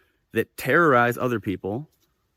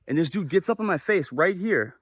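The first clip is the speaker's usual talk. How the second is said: usual speaking voice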